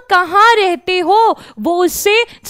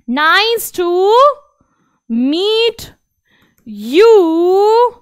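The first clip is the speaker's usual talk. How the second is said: Quel âge as-tu broken into slow separate words; slow syllable drill; much slower than usual. Shown as a demonstration. Teacher slowly breaking down the question for kids. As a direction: drill it word by word for the class. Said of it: "slow word drill".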